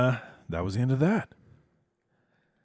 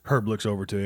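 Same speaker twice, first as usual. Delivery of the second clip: ordinary talk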